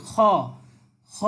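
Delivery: "খ" from just forward in the throat; upper-throat sound. Shown as a demonstration. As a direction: sound "খ" at the top of the throat, isolated. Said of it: "কণ্ঠনালীর একটু আগে থেকে". (কণ্ঠনালীর একটু আগে থেকে "খ")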